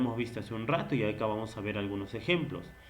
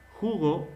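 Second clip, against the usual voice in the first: jugo stressed on JU, falling after; stress on first syllable; 4 semitones higher than usual. Shown as a demonstration. Teacher presenting the example word, jugo stressed on JU. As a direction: say jugo slowly as JU-go. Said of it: "¿Dónde está la prosodia?".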